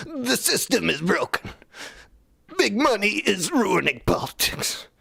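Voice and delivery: high, put-on politician voice; a hammed-up imitation of a stump speech; mock RFK voice. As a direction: lampoon him with a lofty pitch. Mock RFK quote, high, slow.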